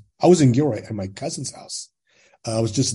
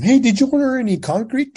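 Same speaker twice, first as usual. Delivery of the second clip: high, louder mock-casual question; re-enacted high-pitched hail; acted doorstep inquiry; 13 semitones higher, 5 dB louder than usual. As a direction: pitch it up, calling to a stranger at the door. Knocking at a stranger's door.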